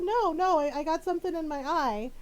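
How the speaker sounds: high, protesting husband imitation; flustered denial. Husband denying he is crying.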